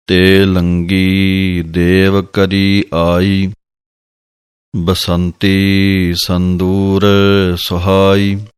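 slow measured scripture chant; slow devotional recitation of gurbani. Shown as a demonstration. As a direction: draw each word out reverently. Recites scripture text slowly.

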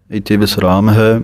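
ordinary talk, nothing marked

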